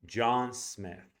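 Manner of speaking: "John Smith" with both vowels long and full; huge vowels. Shown as a demonstration. Teacher showing how a name matters, big stressed vowels in "John Smith". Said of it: "Those two vowels are huge".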